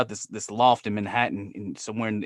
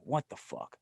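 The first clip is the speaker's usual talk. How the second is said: muttered disbelief; 14 dB quieter than usual. Retold or imitated. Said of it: "I'm like".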